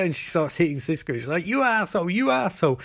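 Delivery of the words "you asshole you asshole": high-pitched retold insult; hammed-up furious squeal; mock rage. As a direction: imitate someone lashing out, voice raised high. Enraged character hitting another.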